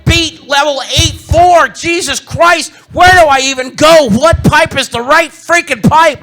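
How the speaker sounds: high, loud, slow exasperated yell; whine-shout like a stuck kid gamer; frustrated teen gamer. Retold or imitated. Teen self raging at a level he can't beat, loud exasperated yell. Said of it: "sixteen-year-old me going".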